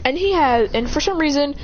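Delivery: plain reading voice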